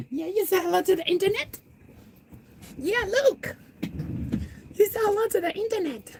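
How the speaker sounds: very high, sing-song voice for the cat. Real, not acted. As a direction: coo at the pet in a tiny voice. Baby talk to pet.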